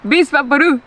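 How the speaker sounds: BISVAPORU as "bees-bah-poh-ROO"; Spanish pronunciation. Shown as a demonstration. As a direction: run Bisvaporu together the Spanish way. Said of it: "how do you say it".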